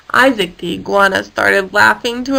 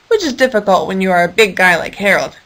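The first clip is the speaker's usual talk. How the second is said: usual speaking voice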